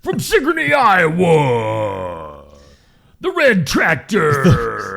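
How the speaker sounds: big slow shouted arena announcer. Acted. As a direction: call it out to the grandstand. Announcing tractor pull entrant.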